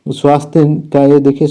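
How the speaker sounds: ordinary talk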